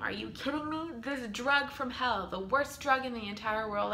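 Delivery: mock outrage at the dreaded drug; exasperated disbelief. Mother recounting being put back on magnesium, exasperated.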